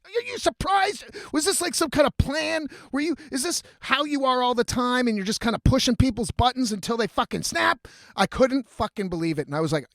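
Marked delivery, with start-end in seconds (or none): 0.1-7.9 high-pitched, disbelieving questioning; incredulous. Retold or imitated. Host reacting to guest's remark about lasting long, incredulous.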